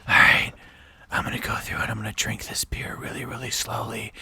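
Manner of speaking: hushed, whispered streamer voice; mock ASMR. Streamer drinking a beer on Twitch, mock ASMR whisper. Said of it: "it was all A S M R".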